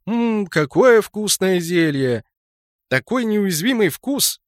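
slow, high, drawn-out relish; savouring aloud. A narrator's reading. Mage pretending to drink the potion, savouring aloud. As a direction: relish it theatrically, stretching the words. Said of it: "громко смаковал и приговаривал".

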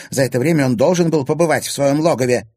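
loud, high, hostile insistence; accusing. A narrator's reading. Stepmother insisting the boy is lying, accusing. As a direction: press the charge angrily.

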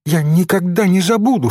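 lightly acted girl's exclamation; fervent cry. A narrator's reading. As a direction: protest it with feeling. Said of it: "вскричала Софичка".

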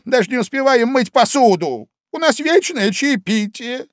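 high, loud, excited Hatter; a lively know-all Hatter; loud, high, bustling Hatter. A narrator's reading. Hatter continues.

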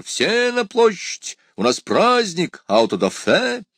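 high, drawn-out festive summons; calling from afar. A narrator's reading. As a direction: cry out to gather a crowd. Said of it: "закричал издали император". (протяжный зазывный крик издали)

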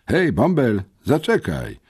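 loud, high call; calling after someone. A narrator's reading. Familiar voice hails the boy on the stairs, calling out. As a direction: hail him from behind, loud and high.

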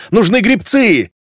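high, drawn-out insubordinate retort; snapping back. A narrator's reading. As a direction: snap back loudly at a superior. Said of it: "огрызнулась Левканоя".

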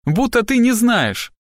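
high, drawn-out outburst; tearful accusation. A narrator's reading. Cornered girl hurls blame at the detective, high and distraught.

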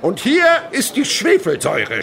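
high, loud cry breaking into a laugh; excited shout. Acted. Chemist shouting to the class about the sulphuric acid. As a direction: shout it out with glee. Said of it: "schreit er".

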